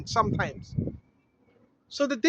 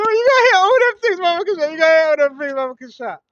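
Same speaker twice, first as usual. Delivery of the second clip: exaggerated shout; 5 dB louder, faster than usual.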